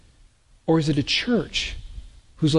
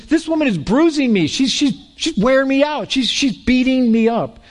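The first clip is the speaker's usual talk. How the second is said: higher, whiny judge pleading he is beaten; a hint of a worn-out judge crying foul; mock judge complaint; 7 semitones higher than usual. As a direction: judge whining that the widow wears him out. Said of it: "He goes".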